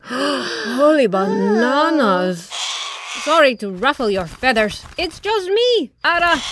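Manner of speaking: apologetic macaw squawk; Ara. A narrator's reading. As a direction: squawk a sorry, rising high on the name. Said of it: "Ara squawked apologetically".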